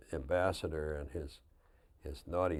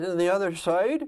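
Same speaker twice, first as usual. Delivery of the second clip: loud, high Irish brogue; Irish bus driver; 13 semitones higher, 9 dB louder than usual. Retold or imitated. Driver asking governor about family roots, Irish accent. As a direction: call out as a hearty Irish driver imitation. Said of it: "John, the bus driver".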